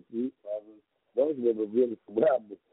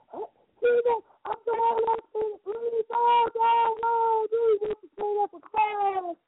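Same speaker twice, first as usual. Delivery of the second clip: much higher, slow put-on girl's voice; squeaky mock-girl voice, drawn out; imitating Tawanda; slower than usual. Tawanda telling Trina to stay for class, in a high mock voice.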